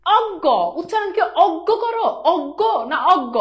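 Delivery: অজ্ঞ drawn out slowly, high and questioning; slow, probing teacher asking which অজ্ঞ is right. Shown as a demonstration. Teacher quizzes students on the right reading, slow and high. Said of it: "না রে ভাই".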